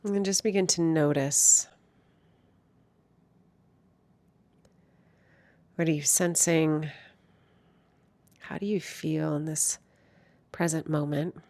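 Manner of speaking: hushed, soothing lead-in to stillness; guided meditation. Real, not acted. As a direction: gently guide listeners inward, unhurried and calm.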